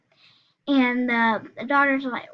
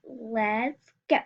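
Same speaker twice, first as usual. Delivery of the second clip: usual speaking voice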